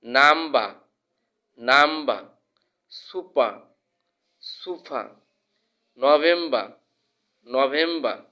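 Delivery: "নাম্বা", "সুপা", "নভেম্বর" ending on open a; silent R. Shown as a demonstration. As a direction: drop the r at the end of "নভেম্বর". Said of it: "আর এর উচ্চারণ হয় না".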